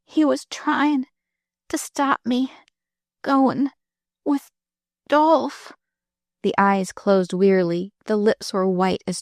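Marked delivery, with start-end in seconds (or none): 0.0-5.7 high, slow, gasping words; gasping girl. Acted. Dying girl gasps out her story to Marilyn. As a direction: push each word out between breaths. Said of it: "her breath coming in gasps".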